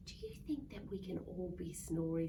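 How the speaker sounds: usual speaking voice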